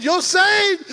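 loud, high joyful cry; overjoyed declaration. Retold or imitated. Mama telling her son he's saved, overjoyed. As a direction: announce it like the best news ever. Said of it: "She said".

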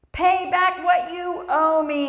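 high, loud, slow demand; a shouted demand for payment. A narrator's reading. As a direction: force the debtor to pay up. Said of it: "he demanded".

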